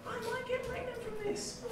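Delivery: very high, hushed little voice; tiny cautious voice. Acted. Comedian voices the cautious thought to the girls, tiny and hushed. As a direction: squeak it out softly, high and small.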